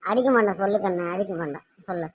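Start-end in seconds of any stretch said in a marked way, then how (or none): 1.1-2.1 much softer, lower gentle promise; soft coaxing. Acted. Mother softly coaxes her scared daughter to speak. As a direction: ease her fear, nearly under breath.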